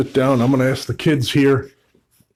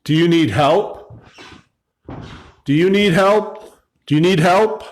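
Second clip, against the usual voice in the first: higher questioning call, rising at the end; raised pitch, repeated appeal; 4 semitones higher than usual. Real, not acted. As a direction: call out an offer of help to someone unseen. Calling out to unseen entity.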